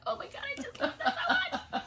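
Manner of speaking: high, laughing gush of delight. Retold or imitated. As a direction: squeal it out with joy. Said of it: "And every day I'm like".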